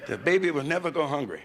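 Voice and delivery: ordinary talk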